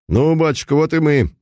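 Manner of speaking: officer arriving at church, cheerful and ringing; cheerful ringing man's voice. A narrator's reading. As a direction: call out gaily like a young officer. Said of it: "весёлым, звучным мужским голосом".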